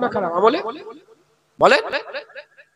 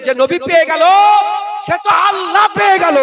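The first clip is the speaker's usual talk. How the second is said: high, loud proclamation; loud preaching; 8 semitones higher, 6 dB louder than usual. Acted. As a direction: shout it to the crowd. First take, loud.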